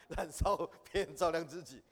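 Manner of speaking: laughing while repeating the saying. Shown as a demonstration. Teacher repeats the saying to the class, laughing. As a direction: chuckle through the phrase, amused at it.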